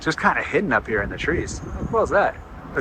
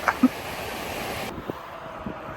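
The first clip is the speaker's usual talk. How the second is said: embarrassed giggle; 6 semitones higher, 6 dB quieter than usual. Real, not acted. Laughing at own name mix-up.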